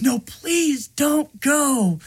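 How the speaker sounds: high, slow imitated wail; mock sobbing plea. Gunsmith begging kidnapped kid to stay, mock sobbing. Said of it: "sobbing, and he's like".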